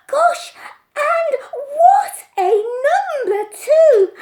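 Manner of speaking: gasping, very high, very loud; small boy marvelling, very high and loud; amazed boy. A narrator's reading. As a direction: squeal with wonder. Amazed child.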